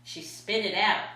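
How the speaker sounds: plain reading voice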